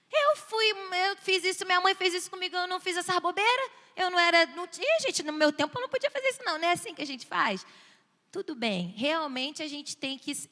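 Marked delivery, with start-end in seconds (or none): 0.1-7.7 high, protesting outburst; acted huffy protest, rushing toward the end; indignant parent. Parent dismissing childish nonsense, high indignant voice.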